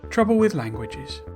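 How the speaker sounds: usual speaking voice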